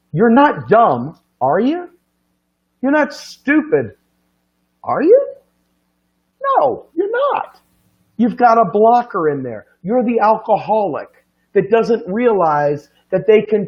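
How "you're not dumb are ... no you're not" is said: rising taunt. Real, not acted. Rhetorical taunt to audience.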